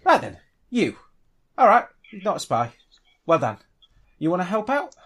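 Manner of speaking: high-pitched, brisk rough-customer banter; excitable dwarf gangster. Acted. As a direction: size up a newcomer, pitched high. Game master plays Vash the dwarf.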